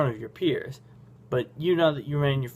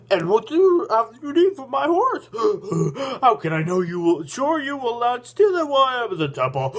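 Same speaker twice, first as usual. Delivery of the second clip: put-on raised, wheezing old stranger with gasps; creepy huffing voice; 7 semitones higher, 4 dB louder than usual. A narrator's reading. Stranger fretting over his horse, creepy and huffing. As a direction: gasp for air between phrases, hammed up. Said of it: "he's got a very creepy voice".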